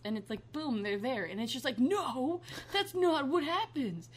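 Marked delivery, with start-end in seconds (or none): 1.8-4.1 loud, higher indignant protest; mock exasperation. Complaining that porn skips the wait for the pool guy, exasperated. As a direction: object loudly as if personally cheated.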